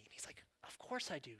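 hushed, tender. Retold or imitated. Jesus asking Peter about love, hushed and tender. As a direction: speak it gently, near a whisper.

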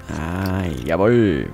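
usual speaking voice

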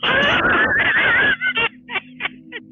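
loud, high helpless laugh; explosive high laughing fit; burst of laughter. Real, not acted. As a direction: crack up at the player's joke. GM cracks up at player joke.

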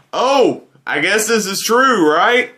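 high, gullible voice taking it on faith; naive believer. Retold or imitated. Viewer fooled by the marketing, gullibly high. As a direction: play the trusting viewer who swallows the hype. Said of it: "you're like".

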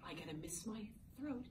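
whispering while clearing the throat. Real, not acted. Teacher whispering to her class before reading. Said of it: "I do this".

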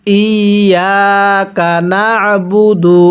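slow, level, drawn-out ইয়াকা না'বুদু; model recitation. Shown as a demonstration. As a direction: hold ইয়াকা long, press the ayn in না'বুদু. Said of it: "পড়ুন".

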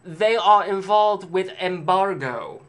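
high, loud, very slow snooty voice; snobby saleswoman. Retold or imitated. Snobby clerk pompously boasting about her store. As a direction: overplay a self-important snob. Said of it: "you're a stuck-up snob working in a clothing store".

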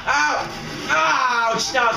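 high, drawn-out cry of pain; pained yell. Real, not acted. Player taking damage in game, pained yell. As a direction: yelp as if hurt.

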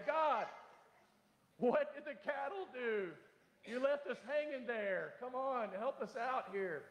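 high, slow, mock bewildered plea; pleading to God. Preacher imagining asking God about the cattle, mock plea. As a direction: plead with God half-joking.

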